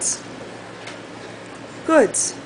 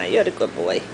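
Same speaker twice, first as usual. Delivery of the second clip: much lower playful voice; deep doggy voice; 4 dB quieter than usual. Real, not acted. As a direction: drop low to praise him. Deep voice to dog.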